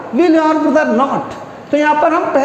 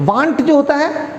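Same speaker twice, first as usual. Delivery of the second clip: ordinary talk, nothing marked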